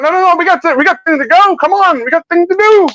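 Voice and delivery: high, squeaky, impatient mock kid; mock hurried kid. Retelling the movie, voicing an impatient kid up high. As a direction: pitch way up and brush it off like a rushed kid. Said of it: "They're like".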